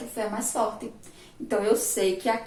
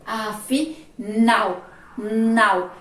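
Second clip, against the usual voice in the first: "Afinal" slowly split, landing hard on "nal", then a loud "Nau"; stress on last syllable; 4 dB louder, much slower than usual. Shown as a demonstration. Teacher showing the strongest syllable, slow with loud final stress. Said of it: "A última sílaba foi a mais forte".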